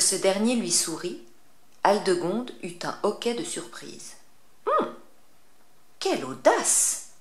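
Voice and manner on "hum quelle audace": much higher, slow, affronted witch voice; an indignant old witch; witch exclaiming. Acted. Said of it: "s'exclama-t-elle".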